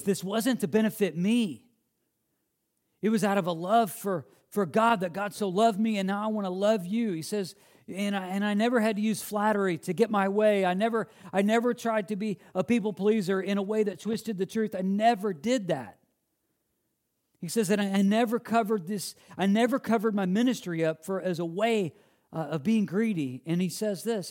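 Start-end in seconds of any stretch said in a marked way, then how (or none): 0.0-16.0 high, loud, urgent defence as Paul; impassioned preaching. Acted. Preacher voicing Paul defending himself to the church, impassioned. 17.4-23.4 high, loud, urgent defence as Paul; impassioned preaching. Acted. Preacher voicing Paul defending himself to the church, impassioned.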